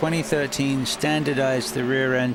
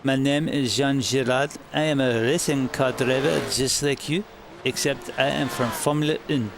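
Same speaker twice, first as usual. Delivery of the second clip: put-on French accent. Streamer repeats a French driver's chat message in French accent. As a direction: ham up a French racing driver's English. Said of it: "That is my best English, French accent".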